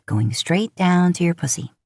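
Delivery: usual speaking voice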